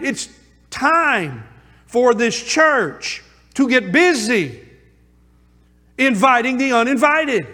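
rally the congregation to action; rousing preaching. Acted. Preacher urging the church to get busy, rousing.

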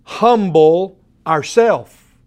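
HUMBLE stretched out slow, pause, then OURSELF; emphatic stress. Shown as a demonstration. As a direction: press "humble ourself" home, each word on its own. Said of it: "The emphasis on this is".

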